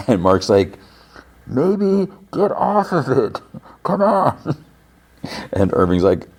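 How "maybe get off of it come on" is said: high, slow call at ordinary volume; mock distant calling. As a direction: imitate calling down to someone far below. Mark calls down, retold.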